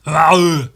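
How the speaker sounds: a loud throaty grunt to find the Arnold voice; Arnold warm-up grunt. Shown as a demonstration. Getting into the Arnold voice: a loud grunt. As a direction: bark out a gruff Arnold grunt. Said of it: "That's how I get into my Arnold voice".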